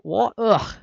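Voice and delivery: loud, high startled yell. A narrator's reading. Reader voices Scout yelling in shock, loud and high. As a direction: yelp it out in shock. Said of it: "yelled Scout".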